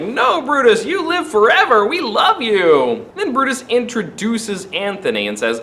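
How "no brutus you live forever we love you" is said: pleading fans. Retold or imitated. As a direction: beg him like a devoted fan. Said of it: "all the citizens are like".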